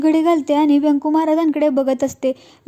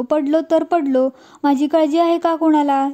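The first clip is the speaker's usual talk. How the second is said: usual speaking voice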